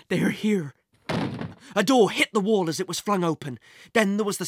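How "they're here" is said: ordinary delivery, nothing marked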